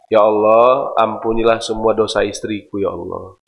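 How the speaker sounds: hushed prayer for forgiveness; humble prayer. Acted. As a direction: pray it near silence. Acted husband's prayer.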